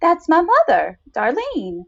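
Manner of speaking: Mara, surprised her guest asks. A narrator's reading. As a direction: voice Mara as if it's obvious. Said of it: "was Mara's surprised answer".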